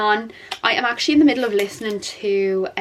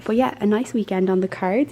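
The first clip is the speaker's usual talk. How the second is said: normal talking voice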